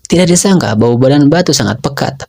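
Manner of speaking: plain reading voice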